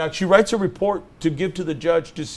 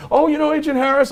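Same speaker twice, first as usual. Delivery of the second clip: much higher, put-on surprised voice; imitated pleasant surprise; 13 semitones higher, 4 dB louder than usual. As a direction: act out sudden recognition, pitch way up. Agent suddenly warms to lawyer.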